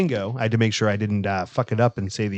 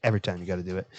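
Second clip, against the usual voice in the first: usual speaking voice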